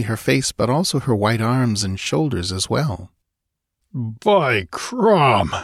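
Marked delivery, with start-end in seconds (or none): 3.9-5.5 grumbling. Acted. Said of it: "grumbled Conan".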